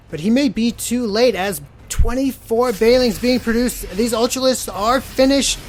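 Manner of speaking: rising, loud, excited commentary; excited play-by-play. Real, not acted. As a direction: call the fight with growing hype. Casting a big fight.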